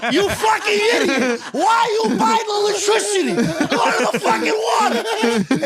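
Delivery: high mock scolding through laughs; laughing dad impression. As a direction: scold like the dad while cracking up.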